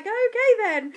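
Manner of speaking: a bemused midwife going along; mock midwife. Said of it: "they were like".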